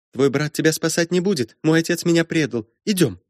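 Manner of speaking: put-on very high, girlish confiding voice; aggrieved girl luring someone away; insistent strange girl. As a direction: coax him along in a high little voice.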